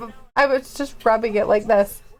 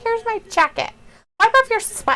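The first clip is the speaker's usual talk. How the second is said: high mock servant voice; 9 semitones higher than usual. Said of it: "He's like".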